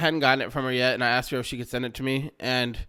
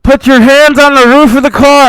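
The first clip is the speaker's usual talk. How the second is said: loud, high barked police command; cop shouting orders; 11 semitones higher, 21 dB louder than usual. Retold or imitated. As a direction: bellow it like a cop at a traffic stop. Cop orders him out loudly.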